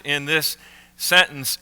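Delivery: ordinary talk, nothing marked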